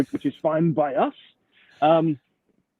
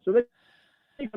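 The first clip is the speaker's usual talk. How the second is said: bad connection; 7 semitones higher than usual. Real, not acted. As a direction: voice cutting out on a call. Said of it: "We're losing you a little".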